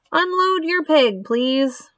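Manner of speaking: high, pompous announcer call; formal fairground announcement; loudspeaker announcer. Acted. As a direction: call it out officially to the crowd. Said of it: "said the loudspeaker".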